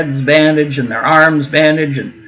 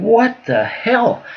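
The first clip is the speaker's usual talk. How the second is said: drawn-out, higher mock shock; shocked exclamation; 8 semitones higher, slower than usual. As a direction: act out the stunned gasp of a witness. Retold shock at sudden punch.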